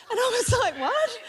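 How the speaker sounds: high laughing "what?", close to tears; real laughter breaking through; laughing disbelief. Real, not acted. Mum's voodoo doll confession.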